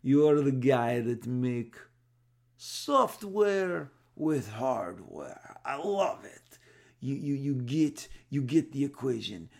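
put-on impersonating a boss with a thick accent, slow and emphatic; Avi Arad heavy accent. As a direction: drag the words out in a thick foreign accent. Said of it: "He talks heavy accent".

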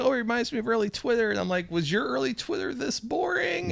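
high, soft, cracking voice. Real, not acted. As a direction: voice climbing until it cracks. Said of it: "my voice is cracking".

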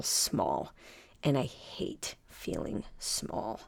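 slow, nearly whispered admission; a slow, breathy, small confession. Real, not acted. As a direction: let it drop to a fragile near whisper. Admitting feeling small.

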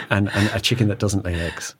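ordinary delivery

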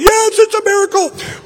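very high falsetto imitation of a flight attendant; mock flight attendant. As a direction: squeak it out like an excited flight attendant. Flight attendant voiced in story.

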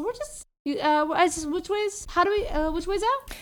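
high flustered voice; nervous squeak. Acted. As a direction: voice jumping up in panic. Nervous bluff to escape.

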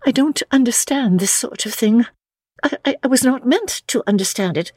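high, quick, stammering protest; flustered, high-pitched young lady; bewildered young woman. Acted. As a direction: plead ignorance in a high feminine voice. Narrator voices Lucy's dialogue.

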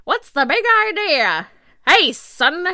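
high, loud nineteen-thirties American accent; 1930s accent. Shown as a demonstration. Podcaster demos an old-time voice for the co-host, hammed-up 1930s accent. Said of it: "in a nineteen thirties accent".

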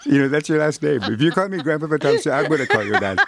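laughing through the made-up name; laughing tease. Real, not acted. Elder jokingly renames the interviewer, laughing. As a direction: tease her with a giggle in every word. Teasing nickname, laughs heard.

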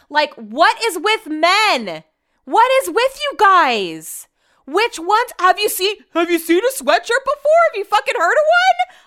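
loud, high-pitched, incredulous rant; shouting in hammed-up exasperation; exasperated outburst. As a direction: build from stretched-out disbelief to high, yelled questions. Exasperated rant at clueless men.

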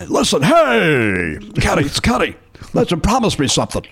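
a hint of a gravel-voiced old manager; bad Ken Severa impression. Host imitates Ken Severa calling Tom, bad impression. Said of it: "my bad Ken Severa impression".

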